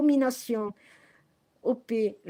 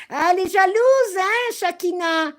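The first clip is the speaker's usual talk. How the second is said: mocking taunt; 5 semitones higher, 5 dB louder than usual. Real, not acted. Taunting a rival on live.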